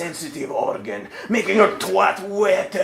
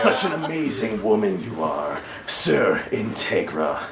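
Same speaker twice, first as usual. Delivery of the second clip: a low, amused vampire count; Alucard voice; 11 semitones lower than usual. Acted.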